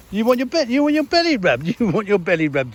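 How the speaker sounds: very high, laughing pet talk; cooing at a dog. Real, not acted. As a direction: coo at the puppy, giggling.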